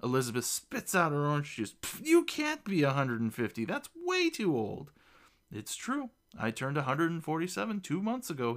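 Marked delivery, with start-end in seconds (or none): 1.7-4.9 high-pitched protest, slowing at the end; scoff, then climb high in disbelief; disbelieving outburst. A narrator's reading. Girl spits out juice at gnome's age, incredulous. 5.5-8.5 high, mildly boastful insistence; proud reply. A narrator's reading. Gnome defends his great age to the girl, proudly. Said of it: "he responds with pride".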